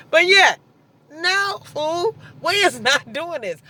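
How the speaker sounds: high, sharp no fool. Real, not acted. Dismissing the ex.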